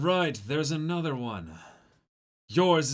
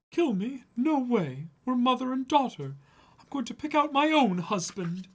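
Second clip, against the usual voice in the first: put-on much higher sweet girl voice; high girlish voice; 11 semitones higher, 4 dB louder than usual. Pudding vowing to choose her husband. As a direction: speak like a sly young bride.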